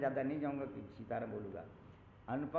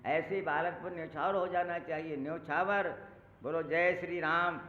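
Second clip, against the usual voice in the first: louder rising fervour, building to the chant; fervent call; 3 semitones higher, 7 dB louder than usual. Acted. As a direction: rouse the crowd with joy. Rousing the congregation. (उत्साह से ज़ोरदार जयकारा)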